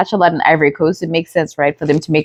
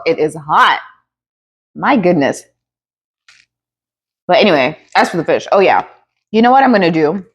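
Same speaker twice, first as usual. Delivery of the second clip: usual speaking voice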